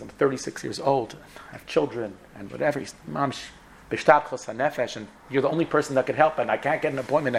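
ordinary delivery

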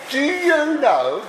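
put-on a self-confessed poor try at a drunk Scotsman's Scottish accent; attempted Scots accent. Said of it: "I can't do a Scots accent".

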